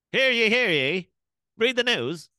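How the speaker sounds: high, loud mock town crier. Friend imitates old-timey talk, mock town crier. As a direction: call it out like an old-time crier. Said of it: "did everybody talk like that".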